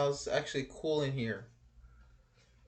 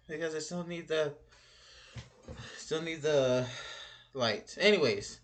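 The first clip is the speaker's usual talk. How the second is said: ordinary delivery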